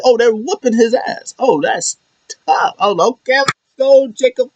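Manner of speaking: high-pitched, hyped-up retelling; excited fan reaction. Retold or imitated. Fan reliving the attack on the manager, high excitement. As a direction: ride it high like a fan cheering a beatdown.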